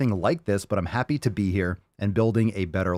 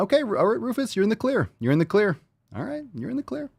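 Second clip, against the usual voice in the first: high sing-song pet voice; dog talk; 11 semitones higher than usual. Real, not acted. Owner forgiving Rufus the dog, high sing-song. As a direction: let the dog off sweetly.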